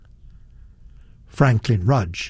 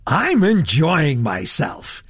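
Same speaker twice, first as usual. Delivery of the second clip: slow, drawn-out, delighted announcement; naive enthusiasm; 3 semitones higher, slower than usual. A narrator's reading. Franklin announcing his delight to Satterthwaite, naively enthusiastic. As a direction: announce it with boyish relish. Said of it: "announced with naive enthusiasm".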